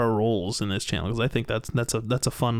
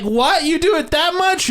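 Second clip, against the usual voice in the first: much higher, louder mock shock; shocked players; 14 semitones higher, 5 dB louder than usual. Gamers reacting to the sheet-washing answer, hammed-up shocked. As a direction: squeal like scandalized players. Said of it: "And they're like".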